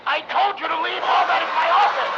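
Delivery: high, loud furious scolding; angry shout. Acted. Rothman scolding his assistant over the dropped compendium, angry shout. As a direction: chew him out for bringing the book.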